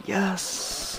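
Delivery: high, excited little cheer; retold delight. Son recalling dad relenting, cheering in mock delight. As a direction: celebrate in a high mock-triumphant voice. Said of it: "I'm like".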